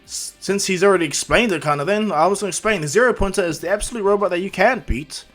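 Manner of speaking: loud announcer. Acted. Announcer explains the zero pointer to examinees, loud projection.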